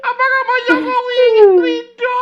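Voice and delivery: very high, wailing, tearful; hammed-up sobbing appeal to the president; mock crying plea. [name] wails a tearful plea to Pak Jokowi. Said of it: "kenapa kamu nangis".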